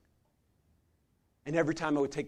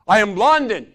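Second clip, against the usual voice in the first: showman announcement; 6 semitones higher, 14 dB louder than usual. Retold or imitated. Showman silencing the crowd.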